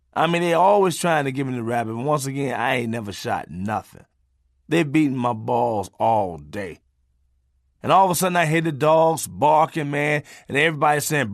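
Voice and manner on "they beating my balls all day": ordinary talk, nothing marked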